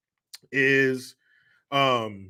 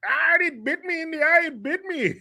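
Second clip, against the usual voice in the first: high yelp, hammed-up Indian dude bitten by a snake; imitating Saul Rosenberg's yelp; mock pained Indian accent; 14 semitones higher than usual. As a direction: squeal it like the snake just bit you. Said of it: "Saul Rosenberg, the Indian dude".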